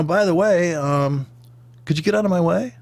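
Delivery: lightly voiced prim politeness; polite British siren. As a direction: ask the traffic to move as a very polite siren would. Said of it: "It's a very polite siren".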